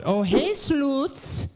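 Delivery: high, put-on cutesy wife voice; hammed-up impersonation, climbing high; mock impersonation of wife. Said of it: "It's an exact impersonation".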